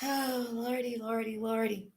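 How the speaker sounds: put-on weary old lordy sigh. Sighing old-timer exclamation.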